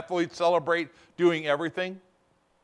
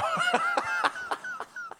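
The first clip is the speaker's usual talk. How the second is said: high laugh; 6 dB quieter than usual. Real, not acted. Laughs after own joke.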